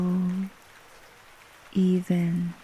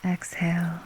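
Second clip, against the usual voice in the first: whispering. Acted. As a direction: whisper it gently to a sleeper. Breathing cue.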